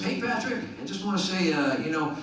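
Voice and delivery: high-pitched, badly faked Virginia accent; hammy Virginia accent; [name]'s terrible Virginia accent. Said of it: "This is a terrible Virginia accent".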